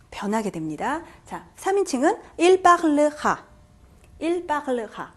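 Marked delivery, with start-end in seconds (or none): 2.4-5.1 il parlera slowly in separate syllables, falling at the end; repeat-after-me model. Shown as a demonstration. Teacher models 삼 인칭 for students, slow careful pronunciation. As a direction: sound out il parlera evenly for learners. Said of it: "다시 한번 따라해보세요".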